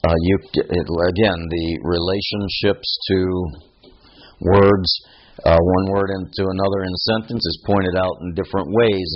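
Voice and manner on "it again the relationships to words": ordinary talk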